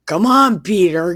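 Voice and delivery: loud acted come-here holler; mock impatient call. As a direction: call him over, hammed-up and impatient. Acting Jesus impatiently urging Peter.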